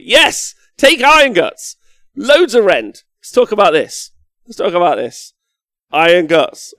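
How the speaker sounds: loud, high hype about the unit; fired-up yelling about Iron Guts; excited shouting. Real, not acted. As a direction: cheer it like a fan. Hyped about choosing iron guts.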